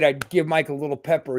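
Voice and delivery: ordinary talk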